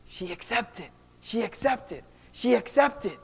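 soft, overcome repetition; hushed, understated joyful weeping; retold tears of joy. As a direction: imitate tearful relief, quietly building. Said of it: "now he's crying even more".

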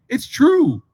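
ordinary talk

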